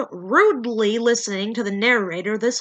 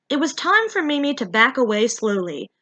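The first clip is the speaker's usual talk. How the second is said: ordinary delivery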